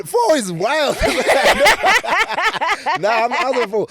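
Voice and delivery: high, loud laughing protest. Real, not acted. Guest laughing about being born in 2004, high and loud. Laughing at own birth year.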